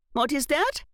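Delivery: sharp question. A narrator's reading. Aunt demanding to know what the code is, sharp. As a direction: cut in sharply, high. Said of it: "Aunt Em asked sharply".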